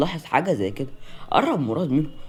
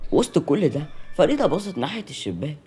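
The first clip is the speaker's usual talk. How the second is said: ordinary delivery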